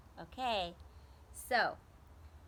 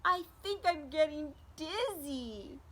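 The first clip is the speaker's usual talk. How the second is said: high, loud, whimpering Bird voice; a small hurt bird, tearful and louder; crying Bird; 7 semitones higher, 6 dB louder than usual. Acted. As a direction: play a little bird crying as he talks. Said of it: "But Bird cried louder".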